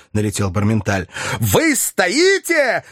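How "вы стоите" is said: slow, high, emphatic yell; shouting. A narrator's reading. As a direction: hammer each word out. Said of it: "кричал Филипп Филиппович".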